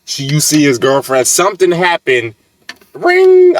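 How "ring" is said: fake phone ring. Friend fakes a phone ringing.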